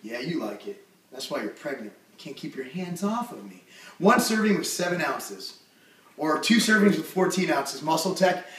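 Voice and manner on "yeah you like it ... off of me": softer, lower cocky tease; quiet teasing. Real, not acted. Teasing his pregnant wife off-mic, low quiet smug tease. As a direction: murmur it smugly.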